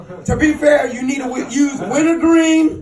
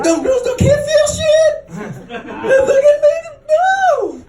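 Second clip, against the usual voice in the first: very high, whiny screech; indignant woman. Retold or imitated. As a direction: wail the objection as an upset woman. Retold woman shrieks her objection.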